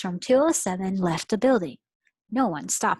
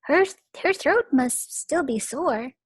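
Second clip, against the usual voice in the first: put-on stammered, higher kid voice; nervous kids; 7 semitones higher, 4 dB louder than usual. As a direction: cover up nervously. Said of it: "said the kids".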